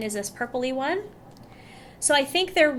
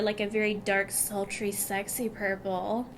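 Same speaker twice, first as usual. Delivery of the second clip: softer, slow sultry purr; 4 semitones lower, 6 dB quieter than usual. Pretend. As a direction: purr the words out. Describing a sultry shade.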